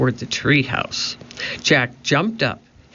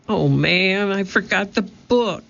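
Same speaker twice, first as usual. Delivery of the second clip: dismayed; 6 semitones higher than usual. A narrator's reading. Jack dismayed at forgotten book.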